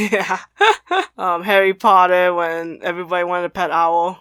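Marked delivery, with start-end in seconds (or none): 0.4-1.1 short, very high laugh; amused laugh. Real, not acted. Podcaster agreeing with co-host's anime example, amused laugh. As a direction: giggle high and briefly.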